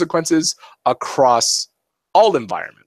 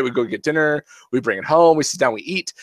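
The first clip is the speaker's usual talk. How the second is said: ordinary talk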